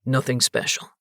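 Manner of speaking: low, dismissive bouncer; a low, bored put-down. A narrator's reading. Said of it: "in shock and irritation".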